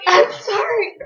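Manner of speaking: high, imitated crying apology; hammed-up weepy sorry; mock tearful apology. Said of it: "I started crying".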